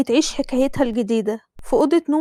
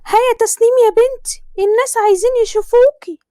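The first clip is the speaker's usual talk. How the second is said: higher, louder pushy Egyptian mother; a mother hurrying her daughter, pitched up; Tasneem's mother; 10 semitones higher, 4 dB louder than usual. Acted. Mother voiced in story. (صوت أم مصرية عالي ومستعجل)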